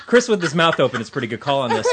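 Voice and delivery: talking through laughter; giggling while getting words out; laughing. Real, not acted. Group laughing at Chris.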